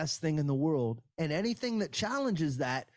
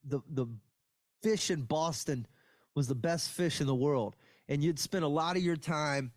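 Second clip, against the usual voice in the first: usual speaking voice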